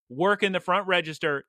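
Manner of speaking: normal talking voice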